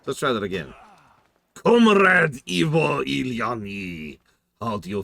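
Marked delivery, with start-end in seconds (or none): 1.5-5.0 higher, louder, slow mock Russian accent; hammed-up Russian accent. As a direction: boom it out like a hammy Russian comrade. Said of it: "the Russian accent?".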